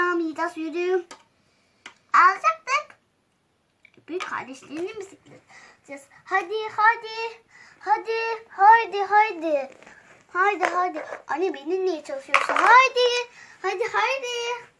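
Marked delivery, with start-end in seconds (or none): 2.1-3.0 loud high objection; shouted protest. Acted. Protesting child. 6.2-14.7 loud high hurrying calls; urging loudly. Acted. Mother hurrying kids.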